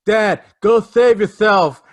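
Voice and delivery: loud, slow, high-pitched mock heroic plea; Chip Shreck impression. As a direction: imitate the son, drawn out and overdone. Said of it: "he said it just like that".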